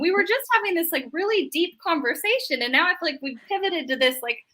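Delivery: playful imitation of parental bewilderment; mock exasperated parent. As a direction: imitate a baffled parent questioning a teen. Exasperated parent voiced to teen.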